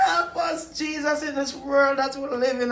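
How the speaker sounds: high, half-laughing half-sobbing prayer; laughing-crying plea. Real, not acted. Man begging Jesus for help, laughing and crying. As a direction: plead with Jesus through laughter and tears.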